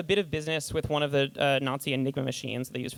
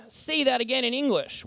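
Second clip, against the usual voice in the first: mock-baffled plea, pitched higher; baffled listener; 7 semitones higher than usual. Writer mocking a baffled viewer of genius. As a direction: play the lost listener begging for plain words. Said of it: "in the ... way".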